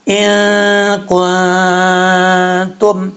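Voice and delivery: ان کنتم with the nun hidden in a nasal hum; slow ikhfa demo. Shown as a demonstration. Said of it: "اخفا ہوگا".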